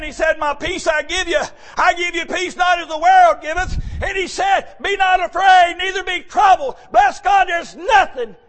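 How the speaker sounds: loud, high preaching of the scripture; preaching at full force. Acted. Preacher proclaiming Jesus' words loud to the congregation. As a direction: proclaim the Lord's words to the church.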